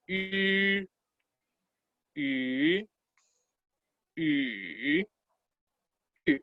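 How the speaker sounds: "Y" как «и» с губами, сложенными для «у»; округлённое и. Shown as a demonstration. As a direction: say "Y" as an i through tightly rounded u-lips. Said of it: "Colocan la boca como la U y dicen la I".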